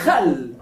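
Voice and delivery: "Hal" said as khal, with a scraping Arabic خ, pitched low; low "Hal" as in خل, kh like Spanish jota; rasped kh. Shown as a demonstration. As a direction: scrape the back of the mouth on the first sound of "Hal". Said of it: "Y hal. Vinagre".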